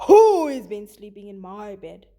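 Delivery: loud, booming bear voice; Papa Bear. Acted. Teacher voicing Papa Bear for students, loud and booming. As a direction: boom it like an angry papa bear. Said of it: "Papa Bear says".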